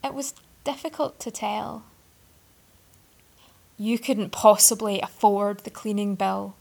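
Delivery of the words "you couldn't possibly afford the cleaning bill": louder, contemptuous scoff. A narrator's reading. Arthur scoffs at the boy's offer to pay. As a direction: look down on him. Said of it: "Arthur scoffed".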